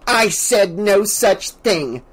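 horrified retort. A narrator's reading. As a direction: deny it, aghast.

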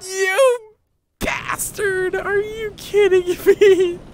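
high-pitched exasperated fury at the game; outraged cry. Real, not acted. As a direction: curse it in high-pitched disbelief. Outraged at game after failing.

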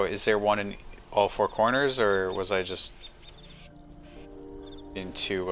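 ordinary talk, nothing marked

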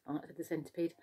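usual speaking voice

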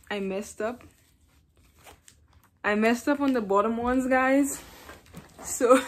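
speaking with strips on teeth; whitening strips on teeth. Real, not acted. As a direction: teeth covered by thin strips. Seen 1: Applied strips just before; teeth look covered throughout the labelled stretch.

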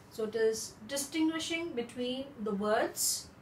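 usual speaking voice

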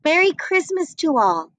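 ordinary delivery, nothing marked

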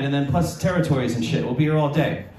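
ordinary talk

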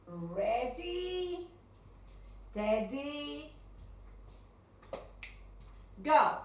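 drawn-out ready, steady, sudden go; game-start call with long pauses; starting countdown. Shown as a demonstration. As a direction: build suspense, then fire off "go". Starting signal for the question round.

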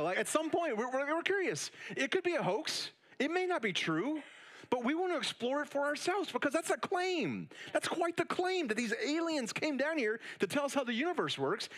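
preacher rising to a passionate peak; fired-up preaching. Real, not acted. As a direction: build up the urgency and push. Preacher builds to emphatic peak.

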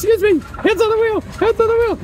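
a pretend rider begging the reckless driver; mock panic. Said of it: "angry road rage driver".

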